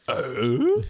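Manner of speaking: loud comic grunt, swooping up high; big questioning grunt with a rising tail; Tim Allen grunt. Acted. Joking about the episode description, loud rising grunt. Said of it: "The description is just".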